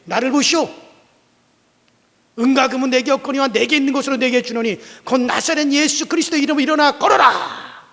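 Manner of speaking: high, loud proclamation; commanding shout. Acted. Pastor commanding the lame man again, loud. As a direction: command him to rise.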